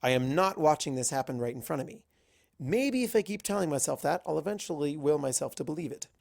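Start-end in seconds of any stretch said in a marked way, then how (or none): none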